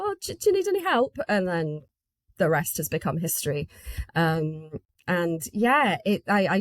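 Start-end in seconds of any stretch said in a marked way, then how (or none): 0.0-1.2 mock-eager offer, higher and fast; retelling how she offered to help at the festival, hammed-up eager; eager volunteer. Bright keen newcomer, much higher and fast. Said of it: "I did that thing where I go".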